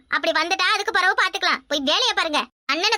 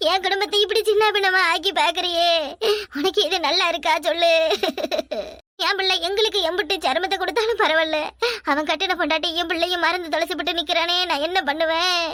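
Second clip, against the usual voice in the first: wailing lament; 3 semitones lower than usual. Acted. Mother cries to God over her ruined family, wailing lament. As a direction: wail in despair to God. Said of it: "இப்படி புலம்புற".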